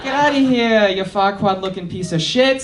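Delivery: loud, hammed-up ogre growling at the donkey; Shrek impression. Scottish friend doing Shrek in a New York accent, hammed-up. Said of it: "he does his best impression".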